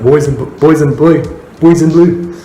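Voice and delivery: "Boys in blue" repeated in a mild imitation of the clip's speaker, rising; imitating his way. Vlogger imitates the streamer's "boys in blue", mild imitation. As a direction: lightly copy how he says "boys in blue". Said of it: "That's, like, how he says it".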